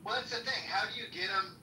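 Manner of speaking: ordinary talk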